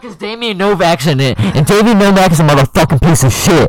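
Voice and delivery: very high, very loud mock scream. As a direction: scream it like a maniac. Screaming insult in put-on voice.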